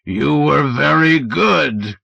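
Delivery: slow booming alien voice; thick tolling voice. Acted. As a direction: toll it out slowly. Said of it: "complimented the thick, tolling voice".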